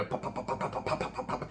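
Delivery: hushed imitation of rapid gunfire; gunshot noises. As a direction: mouth the gunshots under your breath.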